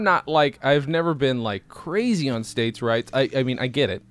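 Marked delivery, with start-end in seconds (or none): none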